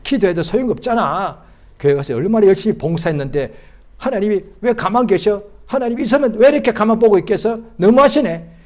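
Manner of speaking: resentful grumbling. Acted. Church volunteer doubting God, building resentful complaint. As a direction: build from grievance to indignant protest. Voices doubting believer's grievance.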